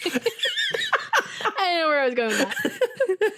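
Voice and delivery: high, slow, laughing through the words. Real, not acted. Host laughing at her own tangent about tenacity, laughing. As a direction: giggle at your own trailing thought.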